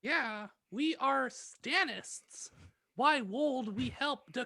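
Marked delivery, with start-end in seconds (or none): none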